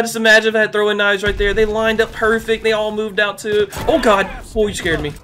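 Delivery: ordinary delivery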